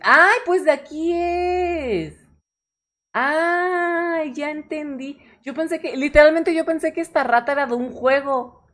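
a sudden high gasp of realization; high-pitched surprise at the artist's own rat; surprised realization. Real, not acted.

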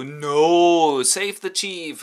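the robbers rally round the chief with a loud slow cry; robbers' loud cry. Acted. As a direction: shout to save their leader. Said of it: "the robbers go".

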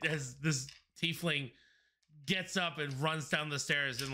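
ordinary talk, nothing marked

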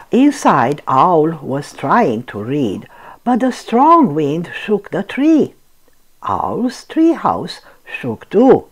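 ordinary delivery, nothing marked

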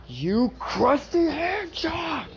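high, slow, hammed-up yell of an insult; mock furious yell. Midoriya charging at Shigaraki, mock furious high yell. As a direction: scream it at the villain like a cartoon hero. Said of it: "he's like".

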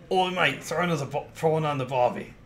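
jokey Australian-Cockney mix. Shown as a demonstration. Streamer testing the voice for the guild master, Australian Cockney. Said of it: "Australian Cockney".

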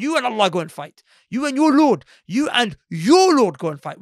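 loud, stretched stress on "your Lord"; insolent refusal. Acted. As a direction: hurl the task back, loud and dismissive. Acts out insolent refusal to fight.

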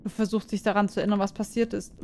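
ordinary delivery

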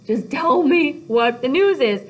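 high, loud, desperate begging; pleading Juliet. Acted. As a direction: beg dramatically, stretching the words. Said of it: "She's like".

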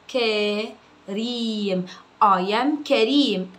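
drawn-out, each word spaced; slow classroom dictation. Shown as a demonstration. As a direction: dictate slowly to a child. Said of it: "كلمة كلمة يترجمها".

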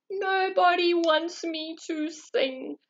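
slow, mournful sheep; sad. Acted. Sheep sadly tells himself nobody wants his song, slow. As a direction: let the words droop with hurt. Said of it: "He was feeling very sad".

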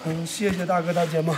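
usual speaking voice

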